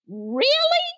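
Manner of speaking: can't believe the offer; amazed. Retold or imitated. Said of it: "I said".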